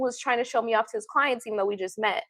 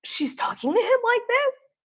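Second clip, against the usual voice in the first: put-on shocked older lady; 11 semitones higher than usual. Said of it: "older lady friend who was looking like".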